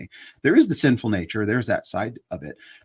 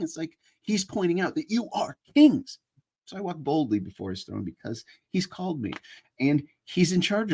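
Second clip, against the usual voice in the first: fervent preaching; 7 semitones higher than usual. Acted. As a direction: preach it, lifting the room toward the throne. Preaching the King of Kings idea.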